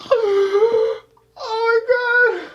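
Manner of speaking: high laughing exclamation. Real, not acted. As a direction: gasp it out laughing. Laughing at forgotten joke.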